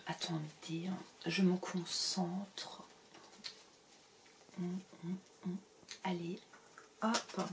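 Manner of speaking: breathy concentrating whisper. Pretend. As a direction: whisper as if focusing. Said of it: "Je change de voix du coup".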